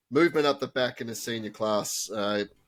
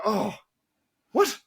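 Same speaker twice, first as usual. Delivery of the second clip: mock shock; 9 semitones higher, 3 dB louder than usual. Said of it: "I go".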